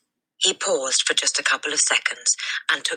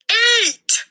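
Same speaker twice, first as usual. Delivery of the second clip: a sudden, much higher, much louder bellow; furious shout; 6 dB louder than usual. A narrator's reading. Headmistress exploding at the boy, furious shout. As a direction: explode at the boy with one word. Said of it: "she shouted".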